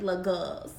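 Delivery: put-on "little girls" said "lil gols", no r in "girls"; New Orleans girls. Shown as a demonstration. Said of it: "as they would say in New Orleans".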